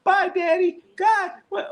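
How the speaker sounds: put-on very high squeaky child voice with a laugh; little kid. Little kid saying bye to daddy, high and squeaky. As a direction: chirp it like a tiny kid.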